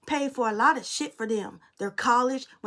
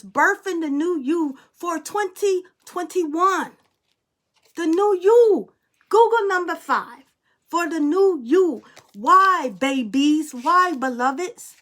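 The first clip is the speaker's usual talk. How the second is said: rising preach; 4 semitones higher, 5 dB louder, slower than usual. Acted. Tarot reading turns to preaching.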